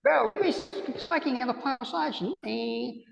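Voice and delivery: put-on high, light New Zealand accent; a hint of a Kiwi bloke. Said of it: "trying a New Zealand accent".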